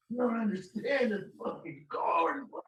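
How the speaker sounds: high, exasperated imitation of an angry woman; hammed-up cornbread tirade; mock yelling. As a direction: wail it in mock outrage. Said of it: "she's just yelling the word cornbread".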